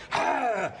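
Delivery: high playful fake war cry; hammed-up shout while play-fighting; mock battle yell. Guards pretend to wrestle.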